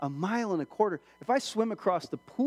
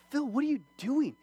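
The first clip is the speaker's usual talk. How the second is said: 1 instructor's protest; 8 semitones higher than usual. Retold or imitated. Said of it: "my instructor's like".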